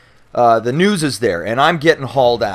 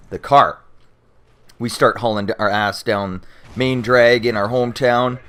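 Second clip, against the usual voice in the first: normal talking voice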